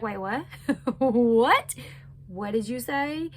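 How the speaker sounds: laughing, rising "what?" in disbelief; mock disbelief. Viewer reacting to the odd voice message, incredulous. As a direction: act floored, half-laughing.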